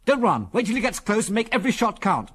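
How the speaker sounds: high-pitched urgent orders; urgent command. A narrator's reading. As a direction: call out orders as danger charges in. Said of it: "jerked out Biggles".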